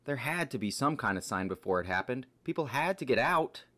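loud, panicked protest; desperate insistence. Acted. Friend insisting people escaped the destroyed city, desperate. Refusing to believe everyone has died.

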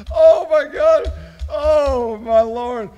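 high, loud cry out of a laughing fit; loud exclamation. Real, not acted. Host overcome by silly suggestions, exclaiming loudly. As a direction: wail it, stretching the second one out.

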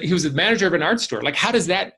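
ordinary talk, nothing marked

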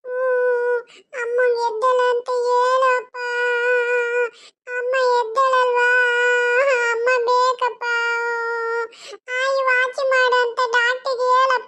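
put-on high, slow child voice begging his father; a small kid asking for his mother; pleading little boy. Adult voices small son. (ಅಪ್ಪನನ್ನು ಬೇಡುವ ಪುಟ್ಟ ಮಗುವಿನ ದನಿ)

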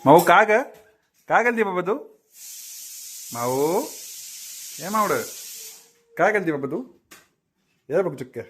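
put-on high, coaxing baby talk to a cat; pet talk. Owner coaxing the cat Mau, high baby talk.

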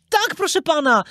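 high-pitched, polite puppet reply; Pinokio. A narrator's reading. Puppet answering the merchant, polite. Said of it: "odezwał się Pinokio".

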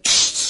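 loud hissing psst for silence; loud commanding shush; sharp loud shush. Acted. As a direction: cut her off with a sharp shush. Clairvoyant suddenly demands silence.

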